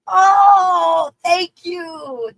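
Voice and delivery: high, loud surprised cry; surprised happy shout; delighted shout. Real, not acted. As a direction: burst out in delight. Reacting to gift.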